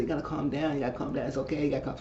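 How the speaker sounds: soothing. Retold or imitated. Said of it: "And Pam said".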